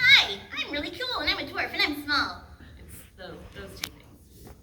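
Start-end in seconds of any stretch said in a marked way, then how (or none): 0.0-2.4 very high, loud cartoon dwarf voice; Paris. Shown as a demonstration. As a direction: belt it out in a high little character voice. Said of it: "And she talks like this".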